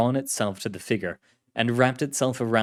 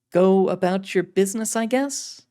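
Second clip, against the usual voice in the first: female agent voice; 9 semitones higher, 4 dB louder than usual. Acted. Carlotta voiced.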